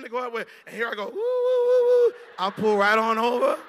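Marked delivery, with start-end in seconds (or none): 1.1-2.1 high, loud wailing police siren; siren imitation. Preacher mimics the cop siren behind him, loud wail. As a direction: belt out the siren sound.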